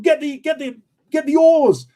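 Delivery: high, stammered frantic order; squealed urgent command, rising in volume; mock panic. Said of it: "then Matt started panicking then".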